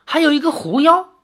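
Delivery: high, loud startled question in a different voice; shocked to hear of a second demon. Acted. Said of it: "李逍遥惊道". (惊讶地追问)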